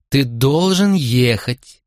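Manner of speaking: slow, insistent stress on "должен"; emphatic command. A narrator's reading. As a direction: stretch "должен" as a desperate order. Mother orders crying son away.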